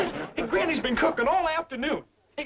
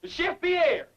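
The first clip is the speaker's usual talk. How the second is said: higher summons in a fake French accent; insistent French-accented call; 7 semitones higher than usual. Host summons missing cook, insistent mock French accent. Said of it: "I forgot my French accent".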